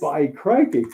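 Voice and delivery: bad mock Aussie accent; hammy fake Australian. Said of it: "That's his really bad Australian accent".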